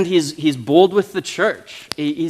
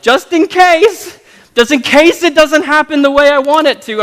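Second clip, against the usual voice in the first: mock hedging pray-er; 12 semitones higher, 8 dB louder than usual. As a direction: squeak out the hedge like a nervous pray-er.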